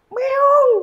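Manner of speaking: very high, loud meow; mock cat meow. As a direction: squeal it like a cat. Imitates absurd reaction to a lion. (meong melengking dibuat-buat)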